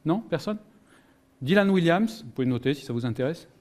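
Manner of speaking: usual speaking voice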